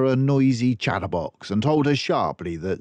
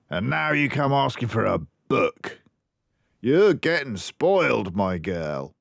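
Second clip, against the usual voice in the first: a hint of a scolding dad; lightly voiced grumpy father; 3 semitones higher than usual. A narrator's reading. Father brushing off his daughter's wish for a book, mildly scolding. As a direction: grumble it down at a child, lightly.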